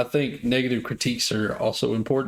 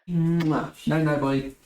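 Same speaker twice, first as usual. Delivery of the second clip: sleepy talk to pet; 3 semitones higher, slower than usual. Real, not acted. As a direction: coo a goodnight kiss to the pet. Saying goodnight to little pet.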